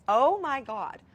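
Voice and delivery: high, loud retold exclamation; exasperated outburst. Wife after her husband laughs, an outraged loud exclamation. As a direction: blurt it out, outraged.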